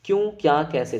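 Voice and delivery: ordinary talk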